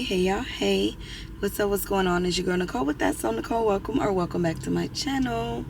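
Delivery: normal talking voice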